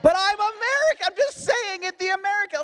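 very high-pitched mock outcry; exasperated retort. Floridian protesting to locals, high exasperated mock outcry. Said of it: "And I'm like".

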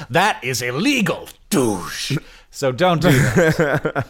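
anti-piracy PSA voice. Retold or imitated. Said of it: "as they say, uh, in the previews".

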